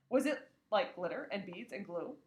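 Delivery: ordinary delivery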